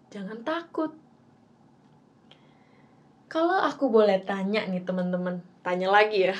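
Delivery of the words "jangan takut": gentle reminder. Shown as a demonstration. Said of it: "Padahal Tuhan bilang".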